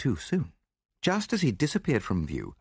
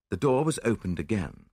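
plain reading voice

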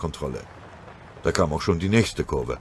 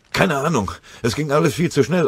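urgent loud answer; 9 semitones higher, 5 dB louder than usual. Acted. Sergeant answering in the chaos.

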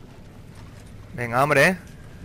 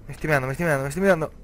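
ordinary talk, nothing marked

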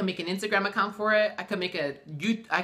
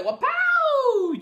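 high shouted sound effect wapow; mock wapow sound effect; 5 dB louder than usual. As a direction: squeal it like a cartoon punch.

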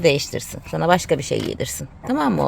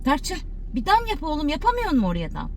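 coaxing dog; 10 semitones higher than usual. Real, not acted. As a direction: sweet-talk the dog into barking.

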